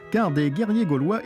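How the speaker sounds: usual speaking voice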